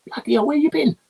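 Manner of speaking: a director bowled over by the song; impressed exclamation. Retold or imitated. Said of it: "comes up to me and goes".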